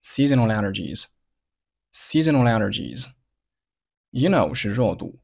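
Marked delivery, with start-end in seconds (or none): 0.0-3.1 "Seasonal allergies" run together as "seasona-lallergies"; linked consonant to vowel. Shown as a demonstration. Teacher shows learners "seasonal allergies" linked, without a pause. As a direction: carry the l of "Seasonal" straight into "allergies". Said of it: "发生了辅元连读".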